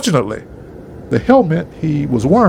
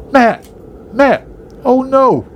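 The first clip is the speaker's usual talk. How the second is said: yelled; 7 semitones higher than usual. A narrator's reading. Fred to father-in-law falling from ladder, yelled. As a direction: yell out as he falls. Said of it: "Fred yelled".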